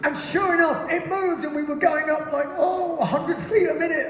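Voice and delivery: very high, excited marvelling; hammed-up wonder, pitched right up; mock amazement. Acted amazement at slow climb.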